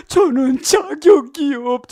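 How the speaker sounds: high, drawn-out mock protest; 자격 없다는 핑계 흉내. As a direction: plead unworthiness in a loud, high put-on voice. 믿는 이의 핑계를 흉내냄. (자격 없다며 높은 목소리로 늘여 말하는 흉내)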